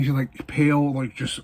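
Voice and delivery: ordinary talk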